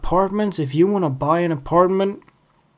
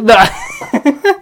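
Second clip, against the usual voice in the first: high rising laugh; high giggle; 10 semitones higher, 6 dB louder than usual. Real, not acted. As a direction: giggle at your own silliness. Laughing at himself.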